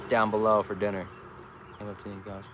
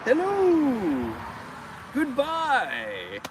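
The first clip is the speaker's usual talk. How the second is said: high, falling hello then goodbye; high drawn-out mock-royal calls; singsong greeting; 11 semitones higher, much slower than usual. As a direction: wave like the queen to traffic. Waving to traffic.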